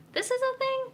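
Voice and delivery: high-pitched incredulous question; baffled reaction. Retold or imitated. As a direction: squeak it out in mock disbelief. Retold baffled reaction to trend.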